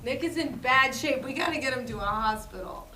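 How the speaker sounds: ordinary delivery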